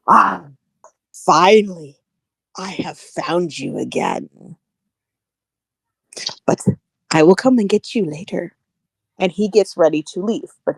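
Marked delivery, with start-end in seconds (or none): 0.1-8.6 lightly voiced villain, slow and deliberate; smirking scientist. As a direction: savor it like a villain who found his prey. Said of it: "And all he says to her is".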